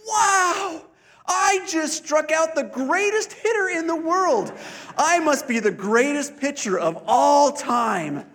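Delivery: put-on high, loud joyful boy voice; boy proudly telling his parents he pitched; delighted kid. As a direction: burst out with a kid's wonder. Boy's surprised delighted realization.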